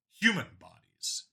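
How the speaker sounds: ordinary delivery, nothing marked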